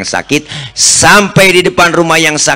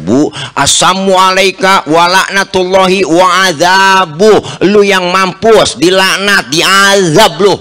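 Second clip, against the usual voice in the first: angry retort; 5 semitones higher than usual. Retold or imitated. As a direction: fire the curse straight back at him. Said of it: "Yang marah Siti Aisyah". (membalas dengan marah, suara tinggi dan keras)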